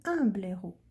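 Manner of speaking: "un" held out clearly before "blaireau"; stress on UN. Shown as a demonstration. Teacher offering pupils the other article, stressing "un". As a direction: lean on the article "un", then say "blaireau". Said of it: "on dira le blaireau".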